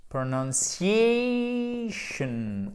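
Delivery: "Pronunciation" with the "a" diphthong hugely drawn out, high; exaggerated English pronunciation. Shown as a demonstration. Teacher drilling the English word, "Pronunciation" extremely elongated. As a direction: stretch the stressed "a" in "Pronunciation" very long. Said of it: "avec un U en anglais".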